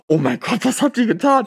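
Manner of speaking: high, panicked inner voice; aghast as the others vanish from the plane; mock panic. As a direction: squeak out the horror of the moment. Panicked thought as friends jump.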